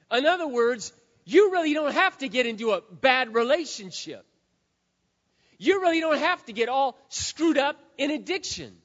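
ordinary talk, nothing marked

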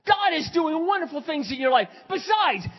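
high, shouted, preacherly encouragement; fervent reassurance. Retold or imitated. Pastor assuring his spiritual daughter she is loved, fervent shouting. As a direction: shout down every doubt with conviction.

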